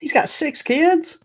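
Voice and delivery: high, slow disbelieving question; shocked. Real, not acted. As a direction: gasp it out in disbelief. Shocked at the news.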